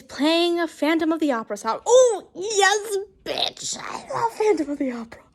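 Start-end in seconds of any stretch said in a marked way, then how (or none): 1.9-5.2 gushing, starts high then drops to breath; excited fan outburst. Real, not acted. Reader interrupting the story to cheer a musical, excited. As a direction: break off to gush over a favourite.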